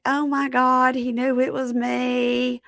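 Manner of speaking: high, drawn-out realization; hammed-up high-pitched dismay; mock-shocked Lisa. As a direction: play her astonished, stretching the words. Voices Lisa's shocked reaction.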